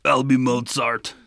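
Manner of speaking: slow mock Austrian accent; Terminator. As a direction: draw it out in a hammed-up Austrian accent. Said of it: "Like the Terminator".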